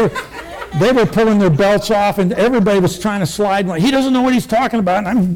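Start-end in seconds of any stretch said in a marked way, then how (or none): 3.8-5.0 retold exasperated yell. Injured man yelling at neighbors who want a tourniquet, raised and exasperated. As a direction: snap back at the neighbors, pitched up.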